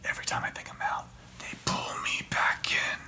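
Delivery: whispered Godfather quote; Al Pacino. Retold or imitated. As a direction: hush it like a trapped mob boss. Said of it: "you're like Al Pacino and, like, Godfather Three".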